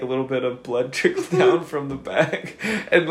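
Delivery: usual speaking voice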